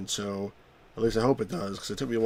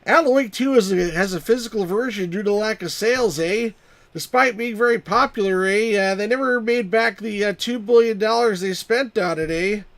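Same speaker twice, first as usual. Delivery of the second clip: high, loud mock Canadian accent; hammed-up Canadian with perky "eh"s; 9 semitones higher, 6 dB louder than usual. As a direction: do a cartoonish Canadian accent, pitched up. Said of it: "That's my Canadian accent".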